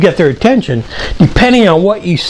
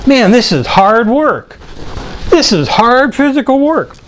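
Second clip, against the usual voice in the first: higher, slow, drawn-out complaint; mock complaint; 7 semitones higher than usual. Boy waxing Mister Allen's car, mock complaining about hard work. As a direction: labour each word in a higher, retold kid's protest.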